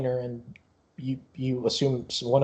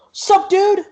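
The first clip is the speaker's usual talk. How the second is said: loud, high-pitched cheeky greeting; brash kid; 8 dB louder than usual. Acted. Yamu walks in front to greet barker, brash. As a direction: pipe up boldly like a child.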